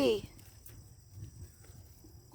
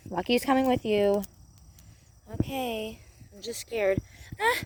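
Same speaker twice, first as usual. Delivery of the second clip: normal talking voice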